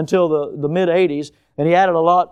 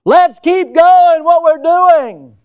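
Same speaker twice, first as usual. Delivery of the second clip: mock cry of the culture; 11 semitones higher, 11 dB louder, much slower than usual. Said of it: "Forward is the cry today".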